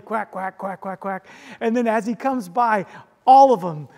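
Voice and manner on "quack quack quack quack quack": imitated duck quacking. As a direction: run of quick quacks. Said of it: "they start quacking away".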